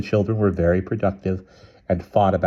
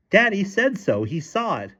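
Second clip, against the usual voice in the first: high-pitched insistent protest; yelling in alarm; 13 semitones higher than usual. A narrator's reading. Frightened mother backing up her spider claim, high-pitched. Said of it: "began pounding on the bed and yelling".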